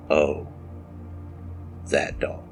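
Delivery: ordinary delivery, nothing marked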